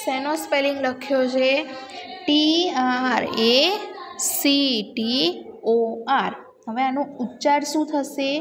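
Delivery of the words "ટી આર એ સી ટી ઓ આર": very slow, letter-by-letter spelling; slow classroom spelling with gaps. Shown as a demonstration. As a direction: draw out each letter for the class. Teacher spells word for class.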